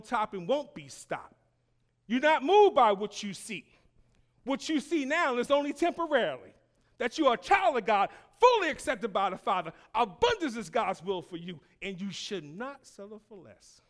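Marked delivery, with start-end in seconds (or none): 2.1-13.8 loud, high, driving sermon declarations; fervent pulpit proclamation; full-force preaching. Real, not acted. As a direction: preach it hard, line piled on line.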